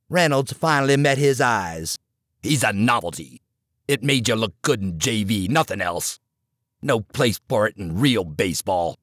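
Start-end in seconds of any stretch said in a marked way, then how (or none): 2.4-9.0 low, hard coach voice cutting him down; stern older coach. Acted. Coach scolding the assistant in the dugout, low and hard. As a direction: lower the voice and press each jab.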